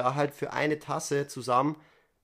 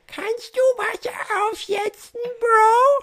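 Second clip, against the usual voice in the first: loud, very high falsetto shout; mock the roommate, stretching out the call; imitated calling out; 9 dB louder, slower than usual. Roommate calls from bed for water to be boiled, high mock yell. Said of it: "dann hörst du so einen Rufen".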